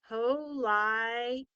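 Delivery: Ho-li-day split slowly as ho-LIE-day with a long i; wrong syllable split. Shown as a demonstration. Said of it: "That doesn't make sense".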